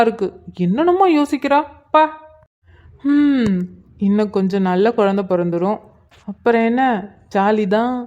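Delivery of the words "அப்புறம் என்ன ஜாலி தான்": ordinary delivery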